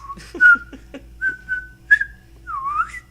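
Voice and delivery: high shrieking laugh, cracking up hard; wild laugh. Real, not acted. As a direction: lose it completely in a shrill laugh. Reacts to creepy board screen.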